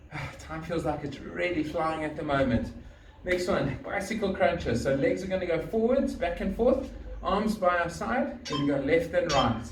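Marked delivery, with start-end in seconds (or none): none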